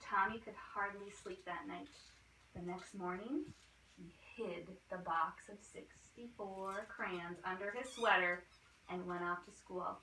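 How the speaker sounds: usual speaking voice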